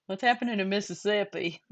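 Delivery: a light mock Southern accent on Mississippi. Shown as a demonstration. Teasing a friend on stream, in a light mock Southern accent. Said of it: "I like [name]'s Southern accent".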